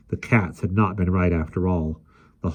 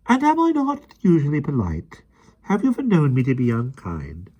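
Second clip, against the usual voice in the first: offended nanny; 8 semitones higher than usual. A narrator's reading. Mary Poppins offended.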